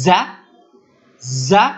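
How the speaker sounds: জা with a buzzing z sound, drawn out; letter sound demo. Shown as a demonstration. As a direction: say জা slowly with a whistling z. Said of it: "দেখেন এর উচ্চারণটা". (জা উচ্চারণ, শীষ দিয়ে)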